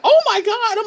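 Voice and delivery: high, loud "oh my God"; mock stunned exclamation; shocked gasp. Retold gasp of Grady.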